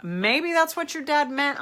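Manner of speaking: normal talking voice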